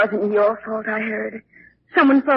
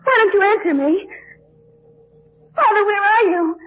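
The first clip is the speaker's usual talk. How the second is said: higher, crying out into the dark; desperate calling; 8 semitones higher than usual. Acted. Daughter calling out to her dead father's voice, higher and desperate. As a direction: plead for an answer.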